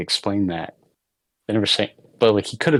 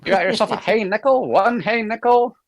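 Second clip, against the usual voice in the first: put-on old-timey barker; 11 semitones higher than usual. Joining the barker bit.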